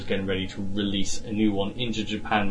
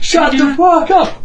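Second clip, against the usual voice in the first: squeaky high slow furious yell; angry gnome shout; 9 dB louder, slower than usual. Acted. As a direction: scream it like a tiny raging gnome. Adult gnome yelling at child.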